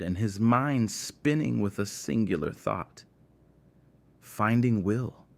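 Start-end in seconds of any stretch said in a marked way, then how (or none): none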